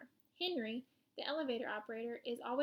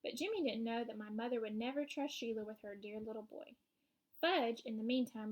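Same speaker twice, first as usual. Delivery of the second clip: usual speaking voice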